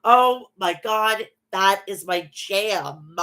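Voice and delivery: ordinary talk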